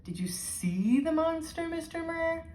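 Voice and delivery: lightly voiced scared girl; frightened girl. A narrator's reading. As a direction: ask in awe, a little scared. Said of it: "uncurling with fear".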